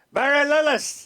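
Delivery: loud, high hail across the lobby; hearty greeting call. Retold or imitated. Sergeant greeting the host in the lobby, loud hail. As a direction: call out his name like an old pal.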